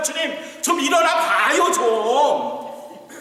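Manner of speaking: panicked disciples shouting at sleeping Jesus; urgent plea. Acted. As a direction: shake him awake with your voice.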